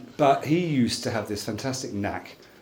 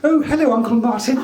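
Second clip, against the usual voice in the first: high, loud little dog voice; Pretty the dog's voice; 11 semitones higher, 6 dB louder, faster than usual. Retold or imitated. Man voices wife's little dog on the phone, high and loud. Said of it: "a voice with inflection and an accent".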